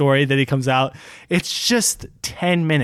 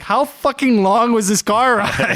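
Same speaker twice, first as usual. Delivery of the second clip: higher, drawn-out incredulous question; Conan's mock exasperation; 7 semitones higher, slower than usual. Conan cutting into a nine-minute joke, mock exasperated. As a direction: stretch it out in mock disbelief.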